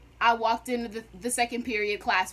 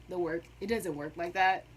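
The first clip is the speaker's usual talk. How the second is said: It doesn't work like that said softer and lower, muttered to herself; quiet repeat; 7 semitones lower, 7 dB quieter than usual. Shown as a demonstration. Student repeating her point to herself, soft and low. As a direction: mutter it again under your breath.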